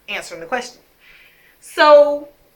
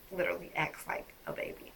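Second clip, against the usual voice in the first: whispered; 2 semitones lower, 15 dB quieter than usual. Real, not acted. Confiding painful detail.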